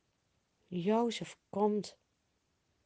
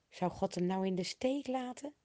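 ordinary delivery, nothing marked

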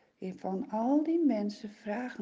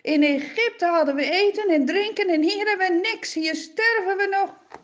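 higher, louder angry shouting; shouting angrily; 8 semitones higher, 7 dB louder than usual. Acted. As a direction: hurl complaints at Moses. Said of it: "beginnen ze allemaal te roepen en te schreeuwen".

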